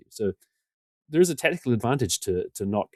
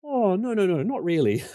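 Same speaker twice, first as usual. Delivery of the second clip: much higher, squirming backpedal; sheepish denial; 11 semitones higher, slower than usual. Retold or imitated. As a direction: wriggle out of it, half-laughing. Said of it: "I'm like".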